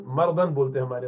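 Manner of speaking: normal talking voice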